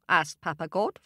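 ordinary delivery

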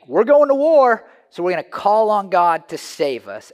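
rally the troops before battle; battle cry. Retold or imitated. Israelites going to war cry out to God, shouted.

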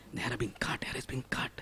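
whispered, breathless with awe; hushed whisper. Acted. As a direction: whisper it like a sacred secret. Solemn hushed moment of haircut.

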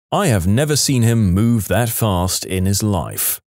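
ordinary delivery

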